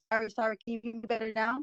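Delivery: normal talking voice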